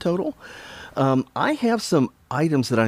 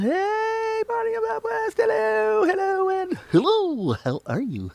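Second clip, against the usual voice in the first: much higher, squeaky put-on voice for the message; voicing a viewer. Streamer reading a viewer's shout-out, squeaky mock voice. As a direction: read the shout-out in a silly high voice. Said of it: "Samuel shouting out".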